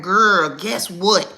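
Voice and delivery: put-on slow, loud excited teen tease; gossipy daughter. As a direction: draw out the gossip hook. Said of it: "daughter to come to me and be like".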